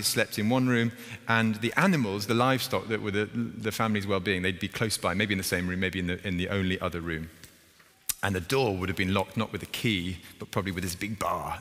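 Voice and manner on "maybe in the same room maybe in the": usual speaking voice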